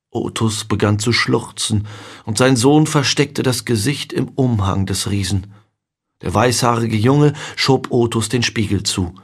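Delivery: ordinary delivery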